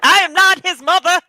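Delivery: high, loud put-on protest; mock indignant mother. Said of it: "You did that well, mother".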